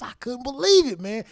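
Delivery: high-pitched mock disbelief. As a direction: squeak out your disbelief. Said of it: "I'm sitting there thinking".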